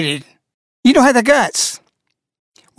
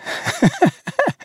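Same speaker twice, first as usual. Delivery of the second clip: high squeaky giggle, soft; little high-pitched titter; 5 dB quieter than usual. Real, not acted. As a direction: giggle that escapes despite yourself. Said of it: "Excuse me".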